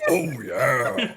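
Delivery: lightly hammed-up Kool-Aid Man catchphrase; grunted catchphrase. Game master voices monster smashing the wall, laughing mock grunt. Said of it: "with a grunt of".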